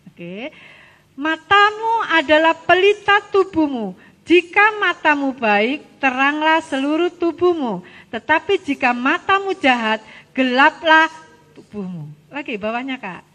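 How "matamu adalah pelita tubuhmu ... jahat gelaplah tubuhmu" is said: loud, measured read-aloud; scripture reading. Real, not acted. Teacher reading Luke with the children, loud recitation. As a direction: project the verse for everyone to follow.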